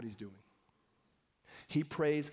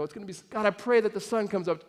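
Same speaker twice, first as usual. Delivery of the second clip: mock safe prayer; 6 semitones higher, 9 dB louder than usual. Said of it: "we look at the weather and go".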